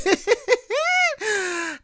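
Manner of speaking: sudden high burst of laughter; big laugh. Real, not acted. Flustered host, wrong clip queued.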